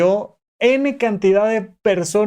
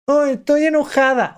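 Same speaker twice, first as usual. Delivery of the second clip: put-on child voice; 9 semitones higher than usual. Girl names her emotion.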